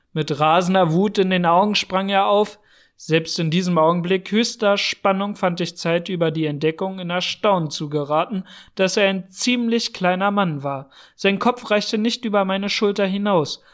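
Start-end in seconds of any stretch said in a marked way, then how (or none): none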